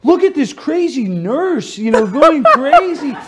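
high, slow, laughing mock viewer; amused viewers. Viewers sharing the clip, laughing and mocking. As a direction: share it laughing at the nurse. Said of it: "They're like".

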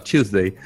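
Tuesday said "CHOOZ-day" as if about to sneeze; Tuesday pronounced with a British ch; sneezy Tuesday. Shown as a demonstration. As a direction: make Tuesday sound like a sneeze. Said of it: "Es como si fueras a estornudar".

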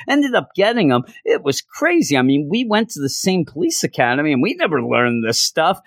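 awed police sidekick. Retold or imitated. Reggie telling Owen about his wife's chase, high awed voice. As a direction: gush about her like the chase still amazes you.

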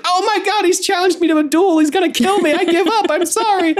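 very high-pitched hysterical wail; mock panicked scream. Bernie, challenged to a duel, surrenders in a mock panicked scream. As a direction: squeal it in hammed-up terror, all in a rush. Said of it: "screaming and like".

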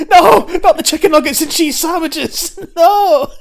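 high, loud hammed-up wail; mock horror. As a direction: cry out like a victim in pretend agony. Plays victim strapped to chair.

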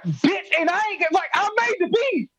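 high, squeaky indignant outburst; outraged disbelief. Retold or imitated. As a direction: shriek it in betrayed disbelief. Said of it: "I'm like".

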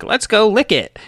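put-on high goofy kid voice; squeaky child, eager. Kids voiced in safety cartoon.

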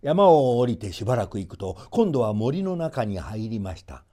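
usual speaking voice

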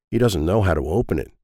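ordinary delivery

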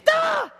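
sudden high yelp of alarm; startled shriek. Retold or imitated. Dad imitating his kid at the socket, a high yelp. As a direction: yelp high like a shocked child. Child about to be shocked.

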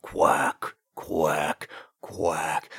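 slow, separate imitation quacks; duck quacking. Mummy duck coming back to her nest, quacking. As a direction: quack like an approaching mother duck.

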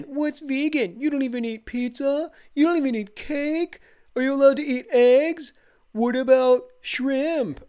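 very high put-on voice, firing off questions; nosy party guest. Dad mimicking guests who pester his vegan kids, high baffled questioning.

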